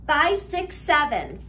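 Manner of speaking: ordinary delivery, nothing marked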